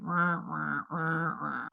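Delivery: soft, dismissive mock whine of the room; mock whining wah wah. Mocking the meeting's complaints with a soft whiny wah wah. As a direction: parody the leaders' endless complaining, softly. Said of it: "It, it was really like".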